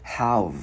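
"Have" closed firmly on the V; closed final V. Shown as a demonstration. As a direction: finish "Have" by shutting onto V. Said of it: "Khép cái chữ V vào". ("Have", khép âm V ở cuối)

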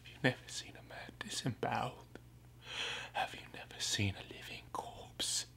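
soft, half-whispered dramatic question; hushed challenge. Acted. Man challenges one who stares at him, hushed challenge. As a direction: drop to an intense near-whisper. Challenging a staring onlooker in horror.

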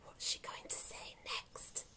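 hushed, conspiratorial whisper; very soft, breath-only question; whispered aside. Real, not acted. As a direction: whisper it to build suspense.

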